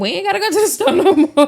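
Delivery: mock the neighbor's chirpy reply, loud and high; high mocking girlfriend voice. Cohost riffs on the neighbor's reaction, high and loud.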